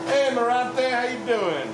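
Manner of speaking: loud, high happy hello; cheery greeting. Real, not acted. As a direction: call out to a friend across the room. Greeting a viewer by name.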